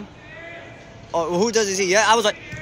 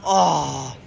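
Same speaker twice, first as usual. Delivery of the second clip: normal talking voice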